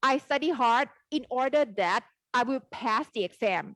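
very slow, clear English for learners; slow model sentence. Shown as a demonstration. Teacher modelling an English sentence for Thai students, very slow. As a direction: spell out each word slowly. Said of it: "พี่จะพูดว่า".

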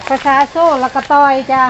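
calling out. Real, not acted. Naming the plant's Thai name.

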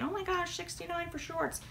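high, breathy imitation of a shocked shopper; mock shocked viewer. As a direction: gasp at the price, mock-scandalised. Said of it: "you guys are thinking".

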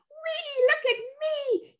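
put-on very high, slow, excited child's whee; a boy showing off on the swing; gleeful whee. A narrator's reading. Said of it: "he said".

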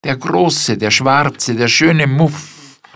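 maid's high, loud, slow lament for the muff. A narrator's reading. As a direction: mourn the muff like a treasure. Maid praises the lost muff.

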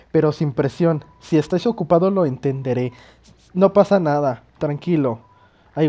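normal talking voice

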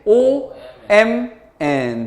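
"오엠엔" very slowly, letter by letter. Shown as a demonstration. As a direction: stretch out each letter of "오엠엔". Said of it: "이렇게 읽어야 되니까 어떻게?". ("오엠엔"을 한 글자씩 아주 길게 읽기)

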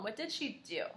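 ordinary delivery

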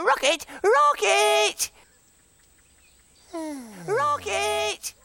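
high, drawn-out call for a friend. Acted. Fimbo summons Rocket to come and play, calling out.

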